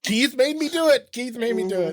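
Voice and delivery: high, whiny put-on voice blaming someone else; mock whiny excuse. Blaming Keith for the dark joke, in a mock whiny high voice.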